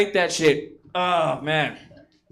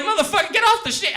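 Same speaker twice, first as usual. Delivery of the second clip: high-pitched scold; 9 semitones higher than usual. Retold or imitated. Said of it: "You're like".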